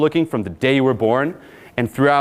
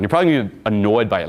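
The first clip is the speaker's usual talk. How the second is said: ordinary delivery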